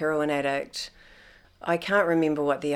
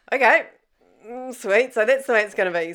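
sarcastic okay; 6 semitones higher, 6 dB louder, faster than usual. Retold or imitated. Inner reaction to being ignored.

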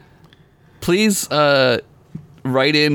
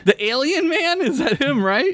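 puzzled; 13 semitones higher than usual. Real, not acted. Confused guess.

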